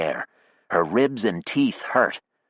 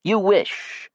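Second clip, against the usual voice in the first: scornful. Acted. Jackie mocking.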